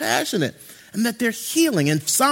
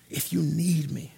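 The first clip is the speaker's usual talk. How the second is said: soft, slow whispered comfort; 5 semitones lower, 8 dB quieter, slower than usual. Acted. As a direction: breathe it like a promise at a bedside. Comforting a grieving family.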